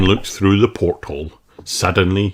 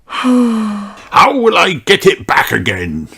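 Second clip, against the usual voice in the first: slow, high, mournful pirate voice; sad pirate; 13 semitones higher, 3 dB louder than usual. Acted. Redbeard laments his lost treasure.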